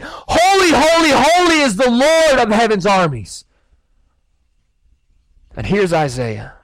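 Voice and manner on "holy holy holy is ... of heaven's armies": high-pitched worshipful shout; shouted angelic praise. Acted. Angels screaming praise to God in a high, ecstatic shout. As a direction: cry it out over a rock-concert crowd. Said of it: "they're screaming and they're shouting".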